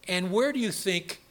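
ordinary talk

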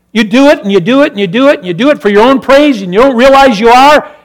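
fast, high and loud repetition; pounding revival preaching; fast fiery preaching. Acted.

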